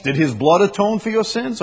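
usual speaking voice

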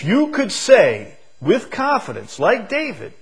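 high, loud rising call; high-pitched preaching call. Real, not acted. Preacher challenging the congregation to say it like David, high and loud. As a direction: reach the back row.

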